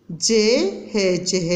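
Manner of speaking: drawn-out sound blending; slow spelling out. Shown as a demonstration. Teacher blending sounds for young pupils, very slow. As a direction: slowly piece the word together. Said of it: "एक बार फिर मिला के पढ़िए".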